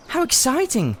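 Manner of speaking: put-on very high, thrilled girl; a girl delighted by a mystery; excited Polly. A narrator's reading. Polly thrilled by Diggory's spooky house. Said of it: "said Polly".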